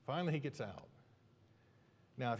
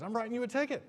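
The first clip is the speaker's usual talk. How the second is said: much higher, louder mock bellow; shouting patrolman; 13 semitones higher, 7 dB louder than usual. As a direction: shout it as an exasperated cop. Imitated officer snaps back at driver.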